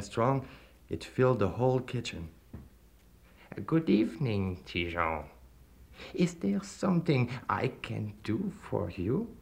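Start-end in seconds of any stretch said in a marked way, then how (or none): 3.6-9.3 slow, silky devil voice; lightly voiced sinister stranger. A narrator's reading. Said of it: "The stranger".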